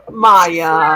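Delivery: usual speaking voice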